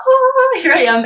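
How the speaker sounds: very high, loud burst of delight; light up with a big "oh"; delighted child. Retold or imitated. Grumpy son brightening up when tickled, delighted.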